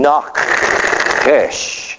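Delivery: Nachesh with a breathy guttural ch from the back of the throat; throaty Hebrew. Shown as a demonstration. Preacher showing the congregation how Hebrew sounds. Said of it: "You have to say that with the back of your throat".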